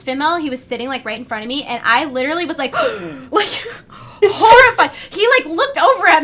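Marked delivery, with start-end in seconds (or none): 2.8-3.2 sudden higher gasp of shock; sharp gasp. Retold or imitated. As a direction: suck the air in, shocked. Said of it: "gasped out loud".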